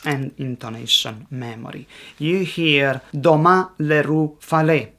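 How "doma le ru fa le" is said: "doma le ru fa le" stressed doMA, leRU, faLE; three evenly spaced words in "doma le ru fa le", each stressed on its second syllable. Shown as a demonstration. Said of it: "stress on the second syllable in".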